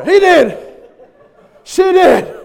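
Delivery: very high, loud mock yell; hammed-up panicky accusation; frantic blame-shifting. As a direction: shout the blame onto someone else. Acts frantic blame of others.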